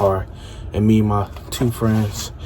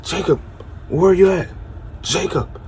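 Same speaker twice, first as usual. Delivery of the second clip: calling out; 7 semitones higher than usual. Retold or imitated. Calling for missing friend again.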